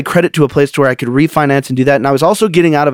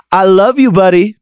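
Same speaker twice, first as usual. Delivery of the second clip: saying it louder; 4 semitones higher than usual. Retold or imitated. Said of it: "I'm just gonna get louder and louder".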